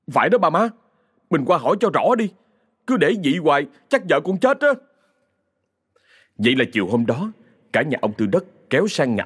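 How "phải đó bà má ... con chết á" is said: put-on urgent young man. Son Bình urges going.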